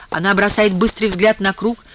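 ordinary delivery, nothing marked

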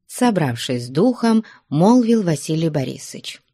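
ordinary delivery

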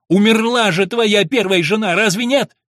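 loud, high angry challenge; heated retort at full voice; cutting accusation. A narrator's reading. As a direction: hurl it at him to wound. Son wounds stepfather in quarrel.

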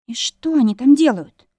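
usual speaking voice

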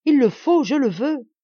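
high, loud resolve; fierce insistence. A narrator's reading. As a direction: declare it unshakably. Insisting on dying.

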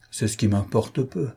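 ordinary delivery, nothing marked

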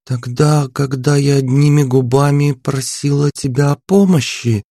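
put-on a frowning girl asking; puzzled princess. A narrator's reading. Princess frowns and asks which other one. Narrator voices princess Anya.

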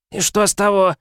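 high, insolent retort; cheeky boy voice. Acted. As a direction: toss it back like a brat.

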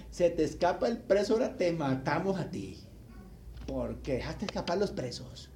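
normal talking voice

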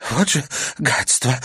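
puffing out the words; panting curse mid-run; out of breath. Acted. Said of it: "пропыхтела Карина".

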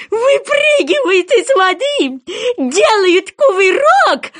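very high, loud, stretched cry; excited shout. A narrator's reading. Goby shouts the leaping steps, excited shout. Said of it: "прокричал бычок".

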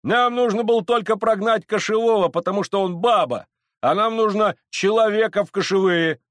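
high, loud heckling; loud, scornful crowd protest; shouting from crowd. Acted. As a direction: shout your demand over the mob. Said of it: "закричали из толпы".

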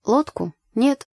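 mild, understated modesty; modest. A narrator's reading. Girl answering her neighbour's teasing, mildly modest. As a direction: answer with a touch of demureness. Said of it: "скромно ответила я".

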